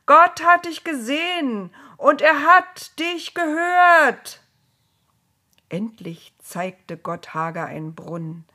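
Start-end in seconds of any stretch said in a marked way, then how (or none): 0.0-4.4 loud, high, slow proclamation; ringing, drawn-out shout; calling out. A narrator's reading. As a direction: call it out to someone far away. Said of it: "riefen sie ihr zu".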